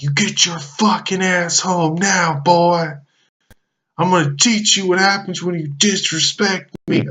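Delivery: high, strained mock yell of an angry dad; dad screaming. Dad yelling at his runaway son, high mock scream. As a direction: play the furious father hollering down the street. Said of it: "he's screaming".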